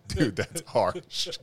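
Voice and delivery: laughing. Retold or imitated. Laughing reply to a friend's jab.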